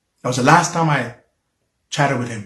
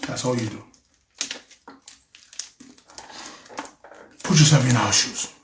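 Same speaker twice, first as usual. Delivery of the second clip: low, soft, halting plea; subdued, real struggle to get words out; struggling to talk; 6 semitones lower, 9 dB quieter than usual. Real, not acted. Pleading with listeners to understand what they went through, struggling to talk. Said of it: "It's hard to talk".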